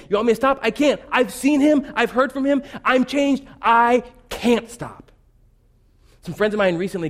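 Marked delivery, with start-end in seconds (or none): 0.1-5.1 impassioned preacher voicing the apostle; preaching at full force. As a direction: plead it like a changed man.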